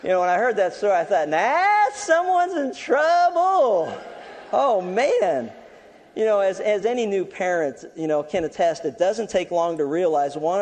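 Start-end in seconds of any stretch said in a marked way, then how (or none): 1.3-5.5 very high, loud, drawn-out mock taunt; teasing singsong taunt. Preacher reacting to the boy's story, high loud teasing singsong. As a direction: squeal it slowly like a gloating tattletale.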